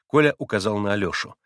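usual speaking voice

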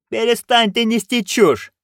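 high-pitched imperious order; commanding. A narrator's reading. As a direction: order them to stop, as an irritated duchess. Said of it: "приказала герцогиня". (повелительно, на высоких тонах)

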